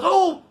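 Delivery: winded gasp. Retold or imitated. Punched in the stomach, gasps.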